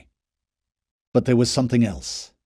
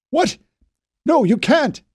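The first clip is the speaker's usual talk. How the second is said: desperate protest; 12 semitones higher, 4 dB louder than usual. A narrator's reading. Knopf protesting the retreat.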